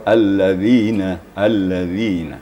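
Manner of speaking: "alladzina" with a soft dh, drawn out; soft tajwid demo; soft dhal. Shown as a demonstration. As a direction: say "alladzina" gently, tongue on teeth. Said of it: "zalnya terasa lembut".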